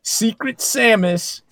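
high, slow hype call; hyped announcer. Acted. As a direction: stretch each name like a hype man. Hyped game names bit.

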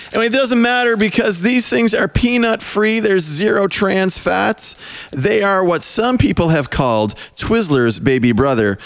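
high bright commercial voice; ad read. Acted. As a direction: pitch it up like an ad. Fake sponsor ad read.

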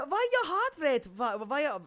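put-on botched Russian accent sliding toward German; high-pitched attempt at a Russian accent; mock a lady's thick Russian accent. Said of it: "Russian accent".

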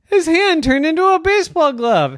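high, loud storyteller burst of wonder; thrilled narration; excited reveal. A narrator's reading. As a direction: make the magic land with a big, bright lift. Story climax, magic moment.

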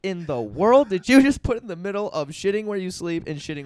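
high, loud mock disbelief; incredulous outburst. Acted stunned reaction to remark.

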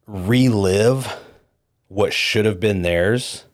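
ordinary delivery

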